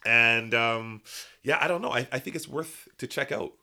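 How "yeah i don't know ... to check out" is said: ordinary talk, nothing marked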